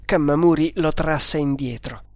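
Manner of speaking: plain reading voice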